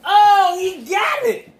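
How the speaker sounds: high, excited exclamation; excited shout. Real, not acted. Celebrating a right answer.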